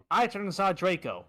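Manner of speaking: normal talking voice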